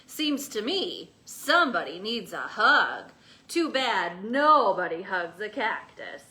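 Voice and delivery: lazy teasing cowboy. Acted. Cowboy teasing the cactus about hugs.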